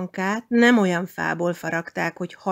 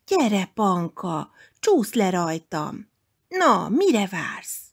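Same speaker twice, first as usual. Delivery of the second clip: slow, coaxing, ending in a whisper; teasing hill; slower than usual. Acted. The hill teasing Panka to slide, slow coaxing whisper. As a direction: lure her down the slope playfully. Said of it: "A domb incselkedve hívogatta".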